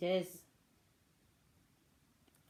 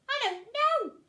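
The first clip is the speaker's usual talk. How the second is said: high, loud little duckling voice; duckling frightened by the rustling, high and unsure; worried duckling; 10 dB louder than usual. Acted. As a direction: answer nervously as the duckling. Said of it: "said the Ugly Duckling".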